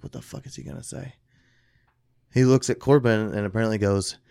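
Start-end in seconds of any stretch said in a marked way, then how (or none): 0.0-1.1 hushed worried aside. Retold or imitated. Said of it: "Trisha's like".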